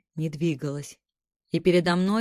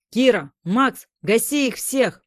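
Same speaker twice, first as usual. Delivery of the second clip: loud, high battle yell; shouting; 5 semitones higher, 6 dB louder than usual. A narrator's reading. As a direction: roar the order to fire. Hero calls out in battle.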